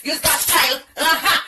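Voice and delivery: ordinary talk, nothing marked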